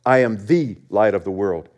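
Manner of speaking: "the" leaned on as "thee"; stress on THE. Shown as a demonstration. Preacher showing there is one light, stressing "the". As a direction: lean firmly on "the", then run on evenly. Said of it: "There's an emphasis there".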